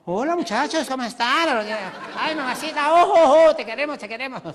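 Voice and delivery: high loud sing-song greeting and whoops; high loud mock greeting; dancer and dwarves. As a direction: coo and cheer, very high. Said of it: "Le dijo".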